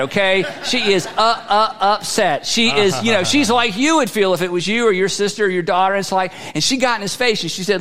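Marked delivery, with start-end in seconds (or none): none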